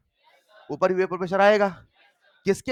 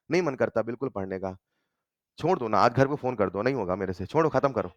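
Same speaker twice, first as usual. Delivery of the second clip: lower-pitched, lightly acted despondent student; mock dejection; 8 semitones lower than usual. Teacher voicing a student who wants to quit, mock dejection. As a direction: play at giving up on studying. Voices a student wanting to give up.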